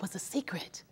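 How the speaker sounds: breathy secretive whisper; whispering as if sharing a secret. Real, not acted. Revealing a hidden secret.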